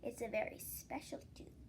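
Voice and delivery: soft whispered suggestion. Acted. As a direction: whisper it like a secret idea. Vaisa whispers again.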